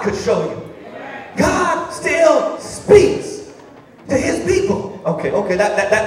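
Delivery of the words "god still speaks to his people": slow, high, drawn-out preaching; preaching at full force. Acted. Preacher to congregation, slow soaring proclamation. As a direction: stretch every word like a revival preacher.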